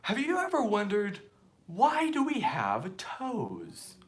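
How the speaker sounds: high punched greeting, then slow wondering question; Michael impression. Host opening like a YouTube show, imitated presenter voice. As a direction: pose the question like an intriguing science show. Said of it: "That was my Michael impression".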